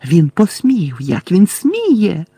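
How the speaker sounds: high, indignant cry; screeching. A narrator's reading. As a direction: stretch the words in shrill outrage. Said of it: "незв'язно верещала Белатриса".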